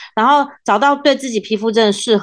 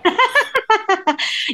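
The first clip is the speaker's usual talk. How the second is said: high bursting giggle; embarrassed laugh; 12 semitones higher than usual. Real, not acted. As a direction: laugh it off awkwardly. Asked about a sad question mark. (尴尬地笑)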